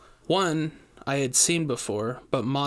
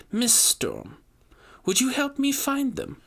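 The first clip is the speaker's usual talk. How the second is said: high, sugary polite ask; sweet request; 12 semitones higher than usual. A narrator's reading. Host asks sweetly.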